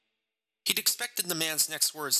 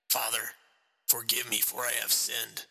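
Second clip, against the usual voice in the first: hoarse voice. Acted. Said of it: "in a hoarse voice".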